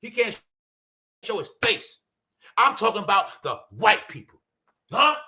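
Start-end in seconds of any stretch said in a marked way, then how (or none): none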